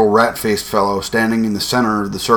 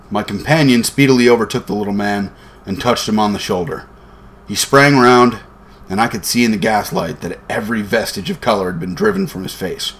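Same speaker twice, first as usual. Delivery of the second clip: ordinary delivery, nothing marked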